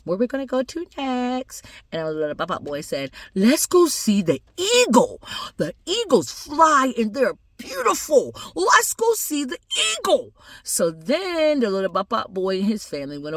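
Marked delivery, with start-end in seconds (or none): 0.0-1.6 high, softened acted voice; indulgent family member. Family speaks to the boy. 3.4-10.3 put-on high, slow, drawn-out child voice; eager little boy. Little boy asking to see the eagles, high and eager. Boy excitedly picks next animal.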